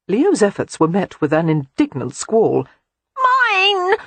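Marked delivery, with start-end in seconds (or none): 3.2-4.0 put-on high little child's squeal; baby boy crying out, much higher; toddler's cry. A narrator's reading. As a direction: shriek it like a possessive toddler. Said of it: "Edward cried".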